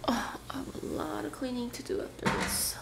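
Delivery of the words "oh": ordinary talk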